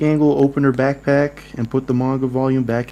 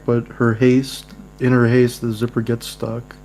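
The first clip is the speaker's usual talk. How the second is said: normal talking voice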